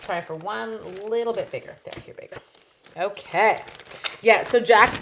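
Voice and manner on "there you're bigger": whispered. Real, not acted. Crafting aside.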